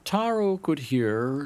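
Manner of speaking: usual speaking voice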